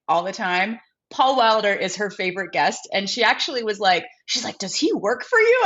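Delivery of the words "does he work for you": much higher, quoting a puzzled mom. Retold or imitated. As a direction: ask it like a confused mother. Mom quoted asking about guest.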